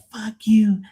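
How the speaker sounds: very high, whiny mock tantrum; mock drunk passenger. Drunk birthday guest cursing the DJ for a song, high mocking whine. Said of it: "They'll be like".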